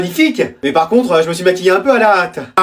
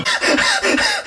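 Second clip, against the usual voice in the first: high mocking laugh; 5 dB quieter than usual.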